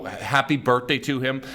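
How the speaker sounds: normal talking voice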